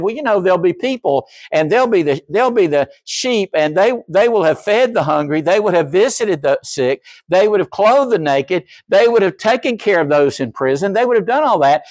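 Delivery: high, storytelling voice of Jesus; Jesus retold. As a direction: paint the sheep gently, rising. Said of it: "he said".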